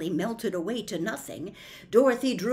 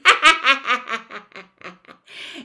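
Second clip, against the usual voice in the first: loud gloating witch cackle; long staccato evil laugh; witch's cackle; 3 semitones higher, 11 dB louder than usual. A narrator's reading. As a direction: cackle loudly as the triumphant wicked witch. Witch gloats over stolen shoe.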